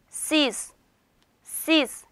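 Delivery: 시스 said carefully as Turkish "siz"; teacher's isolated, clearly articulated 시스; pronunciation model. Shown as a demonstration. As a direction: model 시스 for learners, set apart by pauses. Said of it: "요렇게 읽어요".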